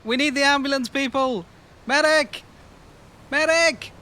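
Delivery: loud, high call for a medic; man down shout. Acted. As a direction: yell out for the ambulance. Calling out for a medic.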